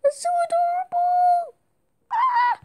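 high squeal of delight. Real, not acted. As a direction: squeal over a cute puppy. Squealing over adorable puppy.

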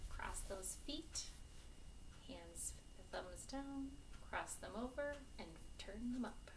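plain reading voice

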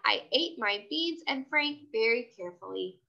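plain reading voice

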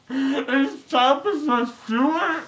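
loopy giggles through the words; giggling. Real, not acted. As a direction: laugh while asking. Loopy patient laughing.

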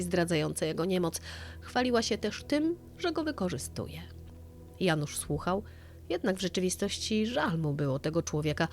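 ordinary delivery, nothing marked

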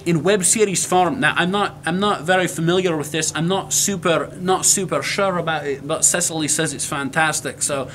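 presenting in a put-on Scottish accent; Scottish brogue. Introducing the web series to viewers, Scottish brogue. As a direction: hold the Scottish brogue while signing off. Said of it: "consistent with the Scottish brogue".

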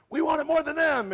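high, loud mock team chant; game-day rallying cry. Team on game day against the other guy, rallying cry. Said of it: "on game day, it's like".

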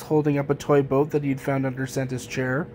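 ordinary delivery

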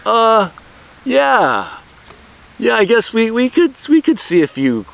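high, reluctant, drawn-out coach; hesitant coach. Retold or imitated. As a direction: play the coach unsure about the late kid. Coach voiced, hesitant reply.

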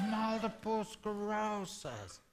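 soft, slow imitation of an ailing woman; sick woman's reply. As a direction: drag it out weakly and very softly. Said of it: "She goes".

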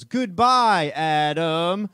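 high, loud alien ride voice. Retold or imitated. Ride's E.T. saying goodbye to dad, high alien voice. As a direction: squeal it like a cheesy alien robot. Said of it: "And then E T goes".

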